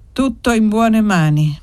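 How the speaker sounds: usual speaking voice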